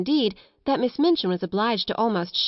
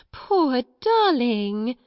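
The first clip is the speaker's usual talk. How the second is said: slow, drawn-out, higher cooing; cooing sympathy; 4 semitones higher, 3 dB louder, much slower than usual. A narrator's reading. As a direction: soothe a wailing child with syrupy pity. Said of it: "first one method and then another".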